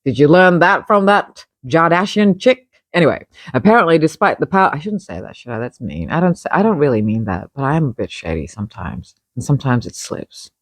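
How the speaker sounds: ordinary talk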